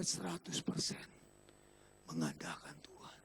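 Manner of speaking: whispered, very soft emphasis; near-silent, earnest hush. Real, not acted. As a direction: drop into a whisper to land the point. Preacher drops to a hush for emphasis.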